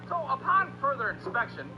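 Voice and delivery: ordinary delivery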